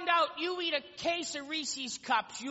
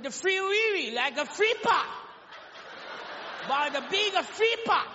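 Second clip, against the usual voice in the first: "free pot" for Free Willy, in a light mock Japanese accent; the chef repeats it, lightly accented; 2 semitones higher than usual. Chef answers the customer in a mock Japanese accent.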